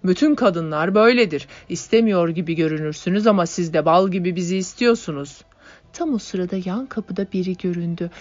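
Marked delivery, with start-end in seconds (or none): 0.0-5.4 pushy suitor. A narrator's reading. Man pressing a cornered girl.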